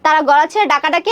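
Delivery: ordinary delivery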